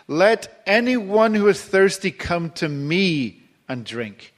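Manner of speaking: slow, high, ringing call to the crowd; loud voice. Acted. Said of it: "stood and says in a loud voice".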